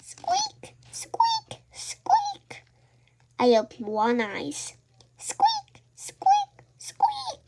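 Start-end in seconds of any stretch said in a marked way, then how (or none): 0.0-2.6 tiny high voiceless squeaks; baby dragon squeaks. A narrator's reading. Baby dragon crying in the night, tiny high squeaks. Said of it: "went squeak". 5.2-7.4 tiny high voiceless squeaks; baby dragon squeaks. A narrator's reading. Baby dragon crying in the night, tiny high squeaks. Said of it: "went squeak".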